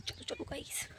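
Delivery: sneaky unvoiced whisper. Real, not acted. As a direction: whisper so mom won't hear. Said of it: "sneaking to mom".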